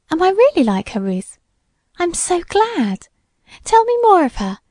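put-on high-pitched, eager girlish joy; delighted. A narrator's reading. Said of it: "in delight".

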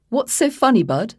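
put-on cheerful posh public-school accent; posh English. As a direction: play a chatty posh toff. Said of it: "sounded like he'd swallowed a silver spoon".

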